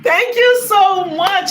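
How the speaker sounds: high, slow, stretched thanks; excited thanks. Real, not acted. Thanking donor.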